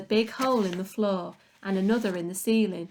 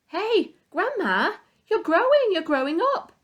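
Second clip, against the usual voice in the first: put-on much higher and louder alarmed boy's yell; startled shout; 10 semitones higher, 6 dB louder than usual. A narrator's reading. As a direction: shout up at her in sudden alarm. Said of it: "George gave a jump and shouted".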